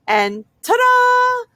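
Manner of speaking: high, slow, loud showman flourish; drawn-out triumphant ta-da, pitched high; grand ta-da. Acted. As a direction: unveil it like a magic trick. Presenting turkey with flourish.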